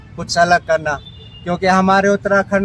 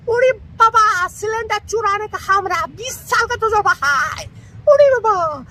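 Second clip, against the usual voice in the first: very high squeaky cartoon voice; cartoon character voice. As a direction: squeak it like a tiny cartoon sidekick. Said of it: "Motu Patlu ... झलकियां".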